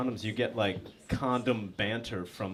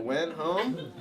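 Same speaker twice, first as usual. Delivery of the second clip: normal talking voice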